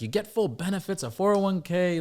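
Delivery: high-pitched imitation of a pleading dad; exasperated dad. Dad selling son on a steady job, exasperated. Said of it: "he was like".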